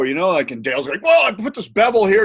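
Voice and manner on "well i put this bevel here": high and loud proud pitch; proud salesman. Retold or imitated. Dale showing Evan his bevel, high proud pitch.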